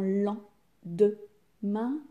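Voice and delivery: lendemain broken into three beats, pauses between; counting syllables. Shown as a demonstration. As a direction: say lan, de, main separately for lendemain. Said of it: "Combien de syllabes".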